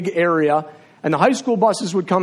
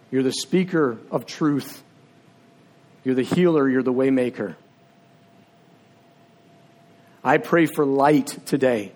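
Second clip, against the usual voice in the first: prayer; 4 semitones lower than usual. Acted. Pastor praying over the congregation, hushed and reverent. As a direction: pray it humbly, letting each name of God land.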